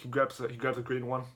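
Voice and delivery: ordinary talk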